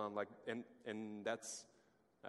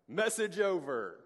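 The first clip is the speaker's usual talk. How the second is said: announcing it; 6 semitones higher, 8 dB louder, slower than usual. Real, not acted. Punchline after Jesus sits down.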